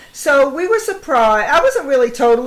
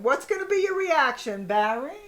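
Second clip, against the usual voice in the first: ordinary talk, nothing marked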